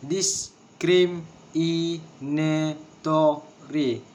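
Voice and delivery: "Discriminatory" very slowly in separate syllables. Shown as a demonstration. Teacher showing a word of six syllables, syllable by syllable. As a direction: break it up, each syllable on its own. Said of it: "six syllables".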